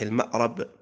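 المأرب said with "a" after the ra, ma'rab; fatha on the ra. Shown as a demonstration. Teacher naming the variants of the fourth word, fatha in المأرب. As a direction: sound المأرب as ma'-RAB. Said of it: "وجاء بالفتح".

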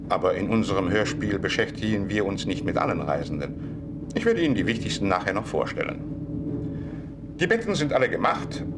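ordinary delivery

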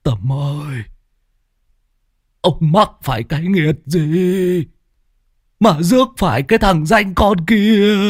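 lightly voiced high, slow old man's lament; feeble old man. Grandfather weakly laments to Tâm about his frogs. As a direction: moan it out like a worn-out grandfather. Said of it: "Ông cụ thều thào".

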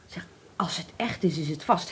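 whisper. Acted. Whispered about valuable object.

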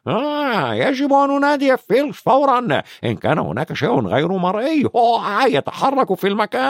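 high, sly poltergeist voice; taunting mischievous ghost, sing-song; Peeves. A narrator's reading. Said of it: "وقال". (صوت بيف الخبيث العالي)

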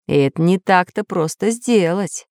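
ordinary delivery, nothing marked

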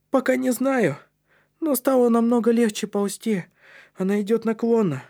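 put-on high, piping child voice; squeaky little boy. A narrator's reading. Матиас reports on the pipe to those below, squeaking. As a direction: pipe it up from ahead. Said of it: "пропищал Матиас".

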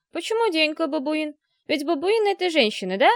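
put-on innocent, puzzled child's questioning; questioning little girl. A narrator's reading. Daughter asks the fathers about baboons, childishly puzzled.

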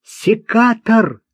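high, drawn-out outraged cry; shouting. A narrator's reading. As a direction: cry out the nickname in agitation. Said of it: "крикнул он". (выкрик, высоко и протяжно)

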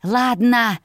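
grudging, mildly hissed agreement. A narrator's reading. Sister conceding to her brother's terms, mildly hissed. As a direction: concede with a touch of venom. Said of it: "прошипела я".

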